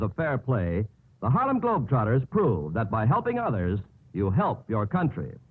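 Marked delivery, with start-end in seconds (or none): none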